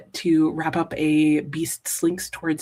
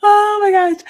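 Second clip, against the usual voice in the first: high, loud burst of delight; a real gasp of amusement, much higher; 14 semitones higher, 9 dB louder than usual. Real, not acted. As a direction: squeal at how funny it is. Laughing at a silly character name.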